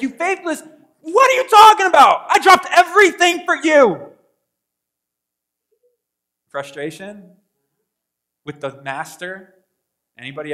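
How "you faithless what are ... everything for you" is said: indignant high-pitched shout; Judas. Acted. Preacher acting a disciple outraged at his master, indignant shout. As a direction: protest loudly, voice pushed way up. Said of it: "Judas saying that?".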